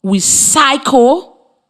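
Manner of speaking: drawn-out we cycle, very slow; slow model; slow demonstration. Shown as a demonstration. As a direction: say we cycle at x0.5 pace. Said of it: "For example".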